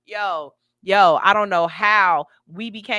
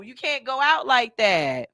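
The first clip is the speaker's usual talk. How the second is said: usual speaking voice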